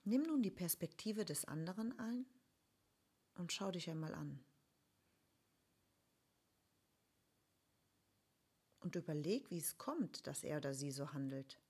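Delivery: ruhige, leise Meditationsstimme mit langen Pausen; guided meditation. Acted. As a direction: sanft durch die Meditation führen. Leitet eine Vergebungsmeditation an. (geführte Meditation, leise und ruhig)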